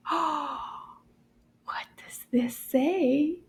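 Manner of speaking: slow, mysterious whisper; suspense whisper. Acted. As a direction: draw them in with a hushed secret. Teacher building suspense. (chuchotement mystérieux et lent)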